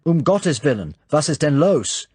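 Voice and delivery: high, loud roused-from-bed demand; angry man in pyjamas at the door, woken at night. A narrator's reading. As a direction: snap at the door, woken and annoyed. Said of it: "he inquired angrily".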